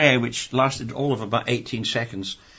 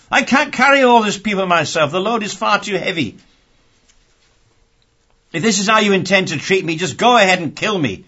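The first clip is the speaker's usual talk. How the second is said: higher, louder pleading complaint; anguished Moses begging to be let off; exasperated Moses; 6 semitones higher, 5 dB louder than usual. Acted. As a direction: cry out to God like a man at the end of his rope.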